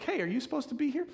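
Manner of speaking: high, quick imitated challenge; challenging bystander. Somebody catching you where you shouldn't be, high mimicked question. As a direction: pipe up fast and high, mimicking someone suspicious. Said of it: "somebody was like".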